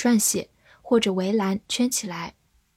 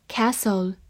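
American "castle" with a front a as in cat; American accent. Shown as a demonstration. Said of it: "美式发音".

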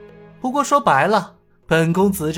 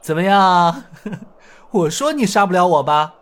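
high, louder teasing voice with a laugh; grinning; 8 semitones higher, 3 dB louder than usual. Acted. Hero grinning at the beaten bandit, teasing. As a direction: tease him with a chuckle. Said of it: "笑嘻嘻地说道".

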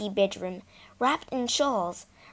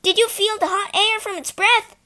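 excited shout; 12 semitones higher, 7 dB louder than usual. Acted. Children shouting at passing train.

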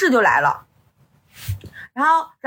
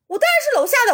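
higher-pitched, curt retort in mimicry; imitating a rude visitor; 10 semitones higher, much faster than usual. Downstairs man answering who he is, imitated as rude. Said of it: "他语气也不好".

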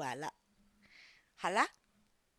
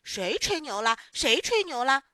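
put-on loud, offended child's protest; indignant retort; 3 semitones higher, 11 dB louder than usual. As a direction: throw the accusation back loudly. Accused boy retorts to jeering.

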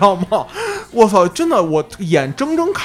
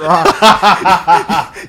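falling burst of laughter; loud laugh; 5 dB louder than usual. Real, not acted. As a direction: laugh hard at the image. Laughing at covered in filth.

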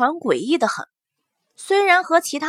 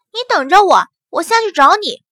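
louder, higher shout downward; calling out; 5 semitones higher, 5 dB louder than usual. Acted. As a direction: call through the floor. Calling down through deck.

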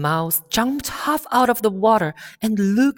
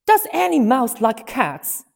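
high angry voice; 6 semitones higher, 6 dB louder than usual. A narrator's reading. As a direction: hurl it back at Alice. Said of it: "in a high, angry voice".

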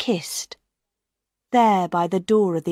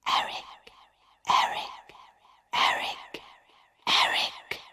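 eerie whisper; 7 dB quieter than usual. A narrator's reading. An unseen voice whispering through the opera house. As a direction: ghostly whisper echoing far off. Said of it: "The word whispered round the opera house".

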